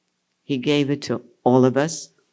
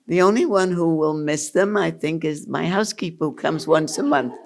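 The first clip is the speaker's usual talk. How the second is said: ordinary talk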